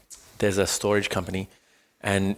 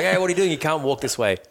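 mock forklift driver; 6 semitones higher, faster than usual. Retelling the forklift driver yelling at him, mock shout. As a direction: play the pushy driver shouting a warning. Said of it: "This forklift driver's like".

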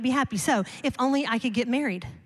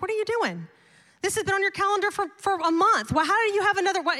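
higher, fast and indignant, retelling the argument; exasperated protest; 6 semitones higher than usual. Retold or imitated. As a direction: throw the question back at him in disbelief. Wife retells arguing with husband.

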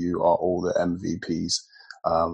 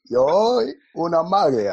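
put-on louder, higher Nigerian-accented Italian; 10 semitones higher, 8 dB louder than usual. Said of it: "why are you speaking in a Nigerian accent".

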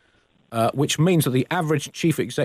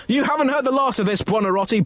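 higher mock blast; 10 semitones higher than usual. The Pope, blasting the artist with a threat. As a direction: threaten with fury.

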